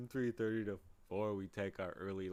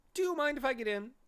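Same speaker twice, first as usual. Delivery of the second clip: eager; 14 semitones higher, 5 dB louder, faster than usual. Acted. Dog eager for ride.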